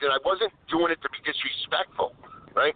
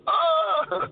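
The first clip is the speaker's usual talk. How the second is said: a high surprised yelp of joy; excited yelp; 11 semitones higher than usual. Retold or imitated. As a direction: yelp like you just won. Finding the colored water.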